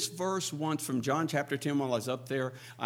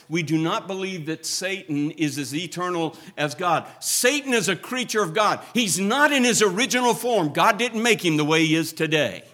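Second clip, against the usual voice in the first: loud, high doctrinal hammering; fiery pulpit voice building up; preaching at full force; 4 semitones higher, 9 dB louder than usual. Acted. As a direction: pound each point home to the congregation. Preacher drives home doctrine.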